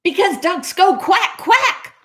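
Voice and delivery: high-pitched, frustrated insistence; exasperated cat setting a duck straight. Acted. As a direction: pitch climbing on each quack. Cat voice scolding the duck in story.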